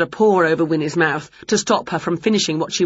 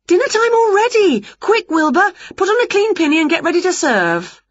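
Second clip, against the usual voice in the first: much higher, louder panicky call; excited witch shout; 12 semitones higher, 4 dB louder than usual. Acted. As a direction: hurry everyone up at full pitch. Winnie rushes to serve.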